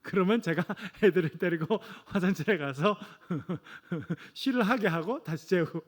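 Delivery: usual speaking voice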